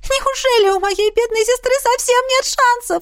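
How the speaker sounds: very high-pitched tearful pleading; sobbing princess. A narrator's reading. Princess pleads with healer for her sister, sobbing. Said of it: "рыдающую принцессу".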